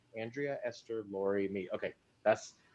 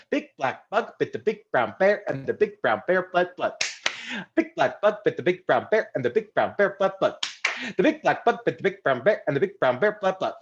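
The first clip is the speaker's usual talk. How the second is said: even faster, tongue twister; 3 semitones higher than usual. Shown as a demonstration. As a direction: rattle it off fast. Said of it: "Even faster".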